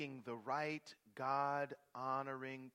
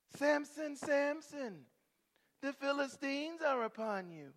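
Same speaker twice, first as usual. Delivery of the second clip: higher, loud calling of the name; Delilah calling out; 12 semitones higher, 6 dB louder than usual. Acted. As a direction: cry out his name to wake him.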